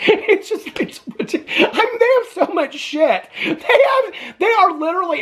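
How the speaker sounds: high-pitched, laughing through the words; laughing in disbelief. Real, not acted. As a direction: struggle to talk while laughing, incredulous. Laughing at the hoarded junk.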